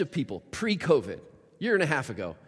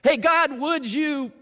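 much higher, louder bright appeal; high, raised plea; eager request; 10 semitones higher, 6 dB louder, slower than usual. Pretend. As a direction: catch God's attention. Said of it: "they ask God for something".

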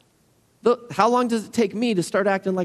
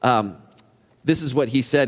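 usual speaking voice

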